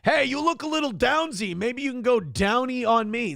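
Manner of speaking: high, called-out come-on; catcall. Acted. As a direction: call it up high to a stranger. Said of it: "[name] catcalls a lady by saying".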